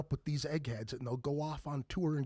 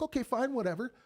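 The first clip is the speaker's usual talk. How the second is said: high, brushing-off okay fine; shrugging him off out loud; dismissive whatever; 9 semitones higher, 3 dB louder than usual. Retold or imitated. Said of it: "And I'm like".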